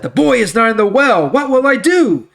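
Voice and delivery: a brother in despair, voice climbing; distraught Reuben. Acted. Reuben finding the well empty, crying out in distress.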